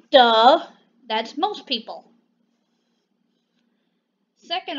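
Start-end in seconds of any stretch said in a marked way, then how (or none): 0.1-0.7 loud, drawn-out "duh"; mocking duh. Real, not acted. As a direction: state the obvious with loud scorn. Mocking reply to critics.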